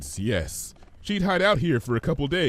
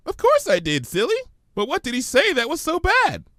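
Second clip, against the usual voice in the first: higher, bouncy curious pony; 4 dB louder than usual. Acted. Pinky quizzing Fluttershy, lightly voiced high bounce. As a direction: ask it with innocent bounce.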